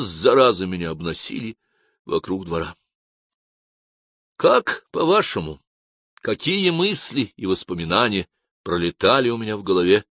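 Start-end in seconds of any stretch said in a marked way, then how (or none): none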